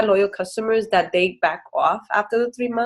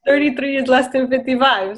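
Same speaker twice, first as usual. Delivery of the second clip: convinced customer; 6 semitones higher than usual. Retold or imitated. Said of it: "They're gonna be like".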